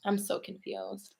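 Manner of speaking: normal talking voice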